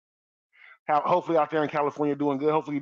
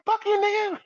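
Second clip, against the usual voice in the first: high mock angry insult. A man cursing out the stud, high and mocking. Acted quote in story.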